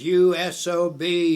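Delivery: loud, high, drawn-out insult; retold bellow, slow and heavy; imitated raving. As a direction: hurl the insult so it carries for miles. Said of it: "Sam started raving".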